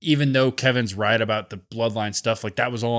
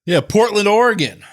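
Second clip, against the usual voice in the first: louder and higher, announcing the host town with a cheer; crowd-pop shout-out of the city; hyped city shout-out; 8 semitones higher, 7 dB louder than usual. Acted.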